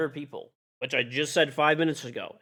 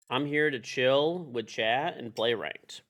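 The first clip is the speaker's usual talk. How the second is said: ordinary delivery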